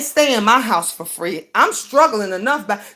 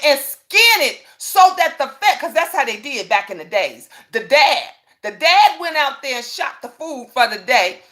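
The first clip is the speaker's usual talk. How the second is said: usual speaking voice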